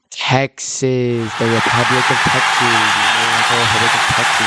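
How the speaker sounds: arena announcer presenting a legend; hype announcer. Pretend. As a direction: bring Texas out like a champion fighter. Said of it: "So let's hear it for the man, the myth, the legend".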